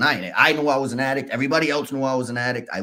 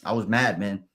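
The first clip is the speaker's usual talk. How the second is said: ordinary talk, nothing marked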